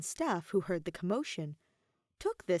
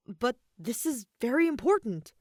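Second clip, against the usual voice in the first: pressing the point, louder; insisting; 5 semitones higher, 6 dB louder than usual. A narrator's reading. Aether insisting to the assistant, firm and raised. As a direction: push back firmly but politely. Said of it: "Aether insisted".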